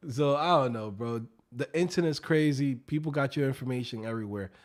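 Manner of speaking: normal talking voice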